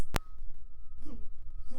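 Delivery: a squeaky, very high burst of laughter; high giggle. Real, not acted. Reviewer laughing at the glowing-eyed shark, high giggle. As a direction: crack up at your own joke.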